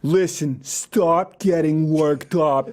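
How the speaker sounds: slow, heavy New York mobster drawl; thick New York accent. Retold or imitated. Mocking the Cuomos in an exaggerated New York accent. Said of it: "decidedly too New Yorkie".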